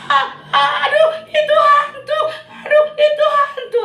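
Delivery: very high, loud scream with nervous laughter; terrified shriek. Acted. Disciples seeing a figure on the water, shrieking in fear. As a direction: shriek at the ghost in terror. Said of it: "Makanya dia bilang".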